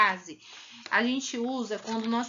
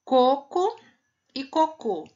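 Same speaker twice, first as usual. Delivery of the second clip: coco stressed first, cocô stressed on the final cô; tônica em co, depois em cô; contrasting stress; 3 semitones higher, 5 dB louder, slower than usual. Shown as a demonstration. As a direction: hit the first syllable, then the last. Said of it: "Tem diferença de coco e cocô?".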